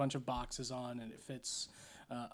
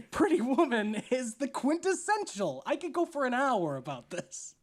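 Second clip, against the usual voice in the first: louder, higher, emphatic argument; passionately making the case, pitched up; worked-up insistence; 7 semitones higher, 4 dB louder than usual. Real, not acted. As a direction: argue it with real heat. Worked up arguing for Pretty Woman.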